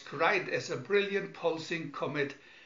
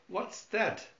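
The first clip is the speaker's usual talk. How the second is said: high frightened question; fearful; 7 semitones higher than usual. Acted. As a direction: ask in fear. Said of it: "Fearfully, Penny asked".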